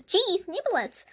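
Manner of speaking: high, loud exclamation; crying out. A narrator's reading. Mouse with sauce up his nostrils, crying out. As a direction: yelp it out in alarm. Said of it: "I cried".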